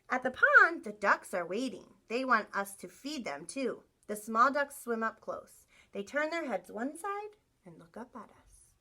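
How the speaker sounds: storybook voice for the class; reading to children. Acted. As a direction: read it like a picture book to kids. Reads picture book to class.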